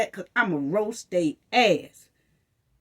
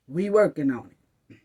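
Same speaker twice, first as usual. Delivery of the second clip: lower, dismissive mock; mock bureaucrat brush-off, lower; mocking officials; 6 semitones lower than usual. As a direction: sneer out the empty promise. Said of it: "another way of saying".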